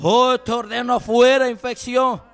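ordinary talk

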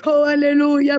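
usual speaking voice

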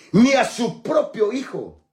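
ordinary delivery

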